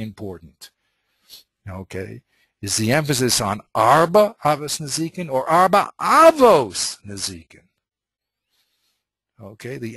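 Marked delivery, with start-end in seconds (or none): 2.6-7.6 "Arba Avos Nezikin" said twice, the stress moved onto "Avos" the second time; shifting emphasis. Shown as a demonstration. Teacher shows his student how the stress changes the meaning. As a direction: ask it twice, leaning on a different word in "Arba Avos Nezikin". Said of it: "where you put the emphasis is very".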